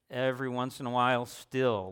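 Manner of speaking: usual speaking voice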